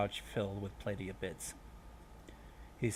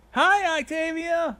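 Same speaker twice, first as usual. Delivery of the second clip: high, loud, drawn-out greeting; chiming group greeting; 11 dB louder, slower than usual. A narrator's reading. Support group greeting a newcomer in chorus, high and loud. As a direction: sing it out like a whole crowd. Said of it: "a chorus of voices chimed".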